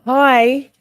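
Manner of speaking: big loud hello to the chat; loud greeting. Real, not acted. Streamer shouting hello to her chat, loud and happy. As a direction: call out the hello like they just walked in.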